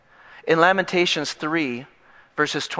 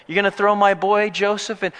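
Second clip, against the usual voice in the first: put-on slight Bronx accent; 5 semitones higher than usual. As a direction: challenge them with a hint of Bronx. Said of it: "he's from the Bronx".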